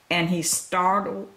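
ordinary delivery, nothing marked

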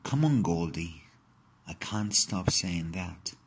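plain reading voice

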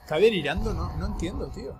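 loud startled question; real surprise; baffled. Real, not acted. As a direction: blurt out confused. Confused by game voices.

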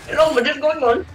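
put-on joking Indian accent. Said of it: "it's really fun talking in Indian accent".

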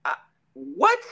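high-pitched, stuttered disbelief; stunned speechless. Retold or imitated. Reacting to his own fight announcement, stunned. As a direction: act out being lost for words. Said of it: "I couldn't even talk".

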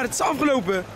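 usual speaking voice

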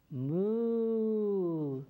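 long drawn-out lowing moo; cow moo. Shown as a demonstration. Storyteller leads kids in a mooing chorus, with a long cow moo. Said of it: "I want everyone to say".

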